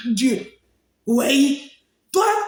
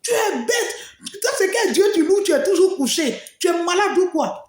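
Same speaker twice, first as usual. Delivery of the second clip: higher-pitched mocking scold, retold; mother scolding; 6 semitones higher than usual. Mother mocking her daughter for praying all day, high scolding voice. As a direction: mimic a nagging mother's scorn. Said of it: "Tous les jours, elle me cite".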